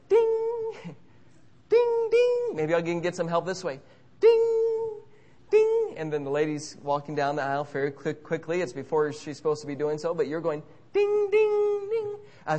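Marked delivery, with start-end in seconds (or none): 0.1-0.7 high piercing bell ding; ping like a flight call bell; high mock bell chime. Pressing call button. 1.7-2.5 high piercing bell ding; ping like a flight call bell; high mock bell chime. Pressing call button. 4.2-5.9 high piercing bell ding; ping like a flight call bell; high mock bell chime. Pressing call button. 10.9-12.2 high piercing bell ding; ping like a flight call bell; high mock bell chime. Pressing call button.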